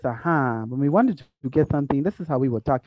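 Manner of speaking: rhythmic, measured Ghanaian-style English; lightly put-on Ghanaian accent. Shown as a demonstration. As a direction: imitate Ghanaian English, each word evenly placed. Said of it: "we were talking like this".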